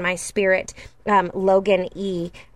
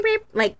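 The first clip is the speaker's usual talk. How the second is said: Roadrunner beep; 12 semitones higher than usual. Retold or imitated. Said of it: "like Roadrunner".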